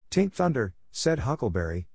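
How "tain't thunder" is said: higher, hushed in awe at the distant boom; awed tone. Acted. Huckleberry to the boys at the strange boom, awed and higher. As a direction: let the wonder lift the voice up high. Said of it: "in an awed tone".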